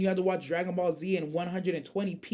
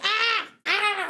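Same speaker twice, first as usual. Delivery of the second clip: high-pitched pretend scream; two short comic shrieks of dread; mock scream; 11 semitones higher than usual. Acting out dread of posting shorts.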